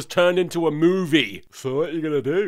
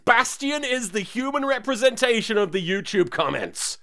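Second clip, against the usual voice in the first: ordinary delivery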